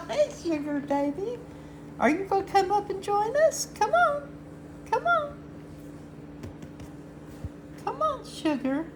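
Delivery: sing-song calling the kitty up high; pet talk. Real, not acted. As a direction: coo to your cat Sugar to come up. Coaxing the cat over.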